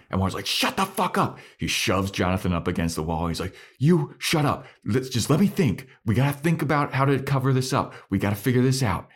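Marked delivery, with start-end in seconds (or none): 0.5-1.4 high-pitched acted exasperation; strained, high "shut it" outburst, acted out; angry outburst. Acted leader snaps at panicking friends. 3.8-9.1 high, urgent acted scolding; worked-up ringleader silencing the others; agitated command. Acted leader takes charge in panic.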